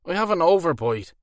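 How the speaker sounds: high, loud thick Irish brogue; Irish werewolf. A narrator's reading. Werewolf explains his overbite to Alora in Irish accent.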